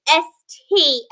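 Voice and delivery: usual speaking voice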